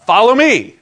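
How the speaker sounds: loud, high shouted command; emphatic exclamation. Shown as a demonstration. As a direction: call it out with a big exclamation point. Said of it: "There's an exclamation point".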